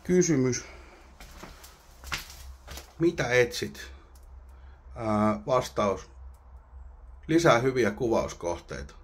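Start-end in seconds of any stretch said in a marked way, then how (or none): none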